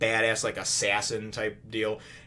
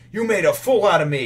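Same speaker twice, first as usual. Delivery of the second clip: mock-indignant, raised voice; louder, higher retold accusation; 7 semitones higher, 7 dB louder than usual. Betrayed sergeant challenges the swordsman, mock indignation.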